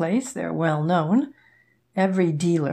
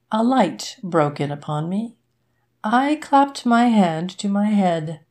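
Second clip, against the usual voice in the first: ordinary delivery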